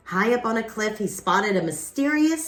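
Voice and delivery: plain reading voice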